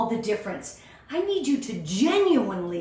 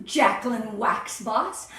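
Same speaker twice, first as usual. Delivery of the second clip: normal talking voice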